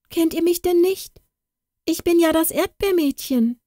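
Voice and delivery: put-on very high pleading girl's cry; desperate plea. Katrin pleading with the kitchen staff, very high desperate cry. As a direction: beg them to recognise her. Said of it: "rief das arme Kind".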